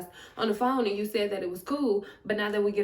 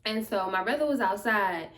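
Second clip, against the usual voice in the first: ordinary delivery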